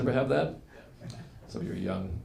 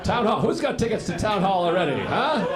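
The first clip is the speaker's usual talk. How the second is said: normal talking voice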